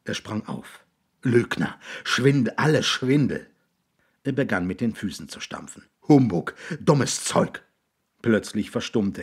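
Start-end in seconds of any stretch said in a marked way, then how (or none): 1.2-3.5 loud, higher angry outburst; furious accusation. A narrator's reading. William jumps up and accuses the boys, furious. 6.1-7.6 slow, loud, higher-pitched rage; furious dismissal. A narrator's reading. William stamps his feet at the boys, furious.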